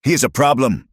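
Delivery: high-pitched, forceful snarl; angry snarl. A narrator's reading. Villain to his henchman, angry snarl. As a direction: snarl the accusation. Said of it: "Cartwright snarled".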